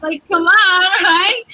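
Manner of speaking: bursts out laughing, loud and high. Real, not acted. Contestant laughing to interviewer about learning her own nails. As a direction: laugh it off as a playful brag.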